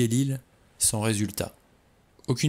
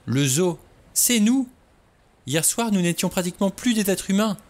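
much higher and louder on "nous"; horrified exclaim; 10 semitones higher, 4 dB louder than usual. A narrator's reading. Vera revealing to the men that they are the zoo, much higher. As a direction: burst out the realisation.

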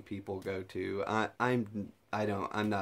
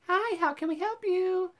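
much higher, chirpy service voice; high mock cashier greeting; drive-thru worker.

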